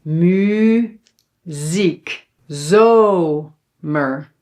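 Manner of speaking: MUZIEK and ZOMER drawn out, first vowel long; long open vowels. Shown as a demonstration. Teacher models open syllables for learners, long drawn-out vowels. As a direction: split syllables slowly, mu-ziek, zo-mer. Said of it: "you have to pronounce it long".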